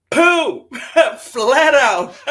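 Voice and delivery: loud, high laughing exclamation; bursting laugh. Real, not acted. Laughing out loud at a viewer's joke in chat. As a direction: crack up and blurt it out.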